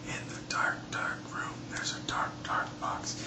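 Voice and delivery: spooky hushed whisper; ghost story whisper. Acted. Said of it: "whispered in her best ghost story voice".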